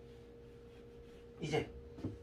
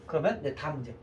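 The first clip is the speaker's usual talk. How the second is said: ordinary talk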